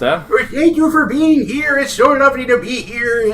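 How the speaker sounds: put-on high, slow quavering old man. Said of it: "so I decided to do an old man voice".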